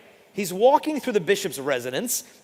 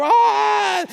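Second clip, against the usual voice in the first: loud, high shocked scream; priest's scream; 10 dB louder than usual. Retold or imitated. Deaf priest screams inside the confessional, high and loud. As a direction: let out a horrified shriek.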